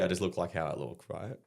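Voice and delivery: ordinary delivery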